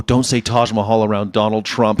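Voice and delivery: normal talking voice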